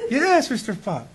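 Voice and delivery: slow, high, sugary yes; sing-song dutiful yes; forced polite reply. Retold or imitated. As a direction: put on sweet compliance through annoyance. Polite outward reply while fuming.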